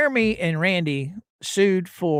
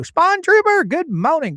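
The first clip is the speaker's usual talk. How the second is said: excited greeting; 11 semitones higher, 5 dB louder than usual. Real, not acted. Cheerful greeting of a viewer.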